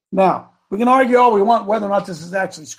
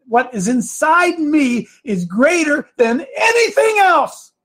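tearful declaration; 9 semitones higher, slower than usual. Real, not acted. Preacher to congregation, tearful and rising. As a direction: voice cracking high with emotion, slowed.

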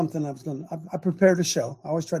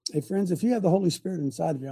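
ordinary talk

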